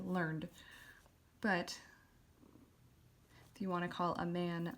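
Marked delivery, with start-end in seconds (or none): 0.1-0.5 learned as lurnd, last part unpronounced; learned as one syllable. Shown as a demonstration. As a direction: run learned straight into its final D. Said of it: "going from the end of the D".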